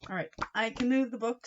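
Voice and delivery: plain reading voice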